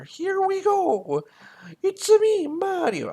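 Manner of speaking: very high, slow cartoon Italian Mario voice; Mario Italian accent. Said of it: "Can I do a Mario Italian accent?".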